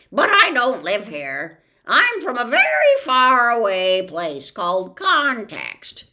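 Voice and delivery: bird voice. Acted. The bird tells Milo where he is from, loud. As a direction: tell of Context like a smug bird.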